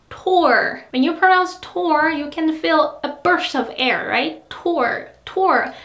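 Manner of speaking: Tour with a strong puff on the T; English Tour aspirated. Shown as a demonstration. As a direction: breathe out on Tour. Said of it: "aspirated consonants are like T in tour".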